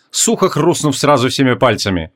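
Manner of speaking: ordinary delivery, nothing marked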